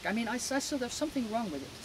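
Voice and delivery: ordinary delivery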